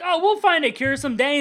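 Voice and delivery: a hint of a hollow promise; lightly voiced comic father; mock dad reassurance. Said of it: "he's like".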